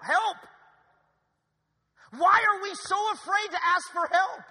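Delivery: loud, high, pleading preacher cry; preaching shout. Acted. Pastor crying out to the church, loud preaching shout. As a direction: shout it out to the pews.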